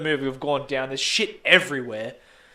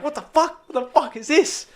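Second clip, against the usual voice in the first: high, loud startled what the fuck; mate hit by a flying bag, shocked cry; shocked outburst; 11 semitones higher, 5 dB louder than usual. Retold or imitated. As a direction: blurt it out, hit in the head, half laughing. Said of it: "he's like".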